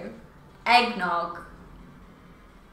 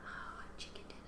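breathy playful whisper; 27 dB quieter, faster than usual. Acted. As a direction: whisper it to yourself. Playful aside after catchphrase.

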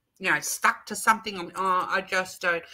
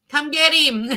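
playful call; 7 semitones higher, 8 dB louder than usual. Real, not acted. Teasing viewer, holding up her dog.

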